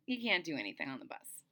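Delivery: normal talking voice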